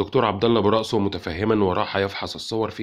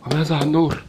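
higher, louder alarmed questions; frightened girl asking about her friend; panicked; 7 semitones higher, 5 dB louder than usual. A narrator's reading. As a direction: demand it in a panic. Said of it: "هتفت سلوى في هلع".

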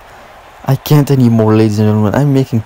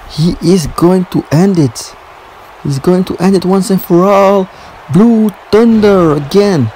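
emphatic play-by-play climax; building excitement; 4 semitones higher than usual. Real, not acted. Calling the expected finish.

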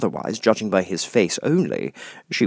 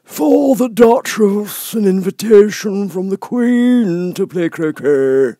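high, slow, pompous footman voice; solemn footman; 12 semitones higher, 8 dB louder, slower than usual. Acted. Fish footman solemnly handing the frog footman the letter. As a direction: announce it with stiff solemn ceremony. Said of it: "saying in a solemn tone".

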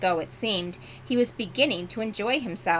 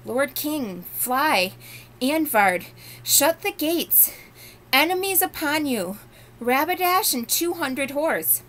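higher and louder, calling out the alarm; urgent warning shout; 8 semitones higher, 4 dB louder, slower than usual. Acted. Shasta warning King Lune of the attack, urgent shouting. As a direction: raise the alarm, slowing on the gates.

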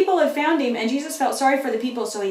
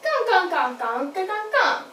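puppet voice; 7 semitones higher than usual. Pretend. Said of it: "Jesus said".

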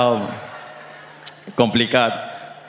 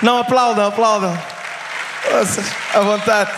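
demanding applause; 6 semitones higher than usual. Acted.

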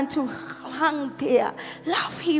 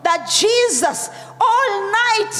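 full-force preaching; 5 semitones higher than usual. Real, not acted. Pastor to congregation on Jesus praying all night, forceful preaching. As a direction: preach it out, stretching the key words.

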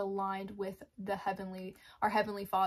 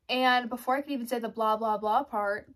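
ordinary delivery

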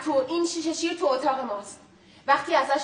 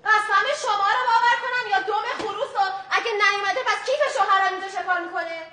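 indignant outburst; 6 semitones higher, 5 dB louder than usual. Acted. Wife confronts lawyer, raised voice.

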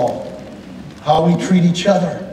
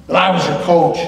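normal talking voice

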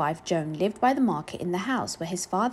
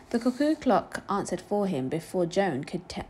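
plain reading voice

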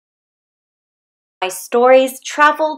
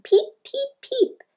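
very high, tiny peeping chick voice; high sing-song chick cheeps; baby chick peeping; 3 dB quieter than usual. Pretend. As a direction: squeak it out like a hungry little chick. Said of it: "The baby chicks are saying".

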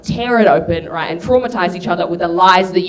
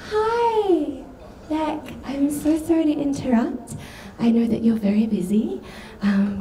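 timid stepmom; 3 semitones higher than usual. Acted. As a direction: tiptoe in apologetically, careful not to intrude. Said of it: "she peeks her head in and she goes".

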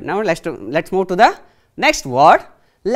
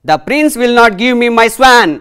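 loud, high demand for justice; a wronged boy crying out to the king; angry complaint; 6 semitones higher, 9 dB louder than usual. Acted. Teacher voicing angry Devdatta.

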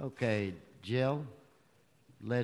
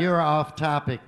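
louder, stern repeat of the warning; firm loud warning; 3 semitones higher, 10 dB louder than usual. Real, not acted. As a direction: raise the voice to cut him off.

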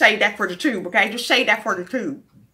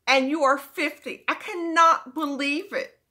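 ordinary delivery

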